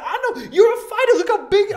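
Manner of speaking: very high, loud, cartoonish excitement; hammed-up fan squawk; imitating gawking stranger. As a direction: yell it like an awestruck onlooker, near laughing. Voicing the random man in the selfie.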